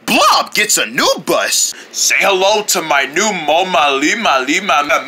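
ordinary delivery

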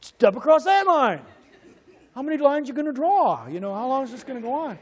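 hammed-up bully daring a kid; mock bully taunt. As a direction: taunt him in a high sneering voice. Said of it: "He says".

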